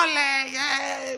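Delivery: high, drawn-out olé without real volume; imitated crowd cheer. Bullring crowd cheering the fight, imitated shouting. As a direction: mimic a distant cheering crowd. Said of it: "la gente gritaba".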